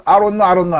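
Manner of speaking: "I don't know" slurred quick like "ai-ro-no"; fast linked speech. Shown as a demonstration. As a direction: rattle off "I don't know" with the sounds blurred together. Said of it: "미국인들은". (미국식으로 빠르게 뭉개서)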